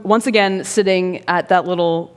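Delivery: ordinary talk, nothing marked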